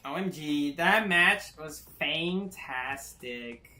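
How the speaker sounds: put-on airy valley girl drawl, slow. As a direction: play a bubbly valley girl. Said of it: "in the Valley Girl voice".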